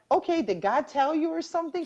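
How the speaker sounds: high-pitched disbelieving question; retold incredulous question. Asking her friend about the healing claim, retold incredulity.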